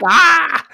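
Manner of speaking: high, loud shriek; short comic scream of alarm; mock startled scream. Imitating the woman's startled scream.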